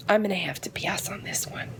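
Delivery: soft whisper, backing out apologetically; whispered refusal. Retold or imitated. Declining the doctor's unpaid study, in a whisper. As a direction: breathe the no out under your breath.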